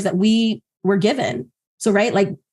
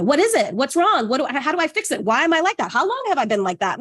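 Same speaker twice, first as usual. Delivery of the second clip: rapid-fire, higher-pitched questioning; mock frantic hunt for a fix; self-interrogation; 4 semitones higher than usual. Person grilling themselves about a pit in the stomach, frantic. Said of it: "ask the questions differently than the".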